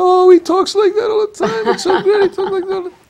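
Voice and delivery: very high, loud, laughing gush; imitating an overexcited lady. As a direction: squeal it out with delight, laughing through it. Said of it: "She goes".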